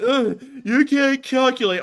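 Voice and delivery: high, loud, slow mocking imitation; mock the complainers in a high voice; mocking critic. Imitating people who said it can't be done, mocking. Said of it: "in a mocking tone".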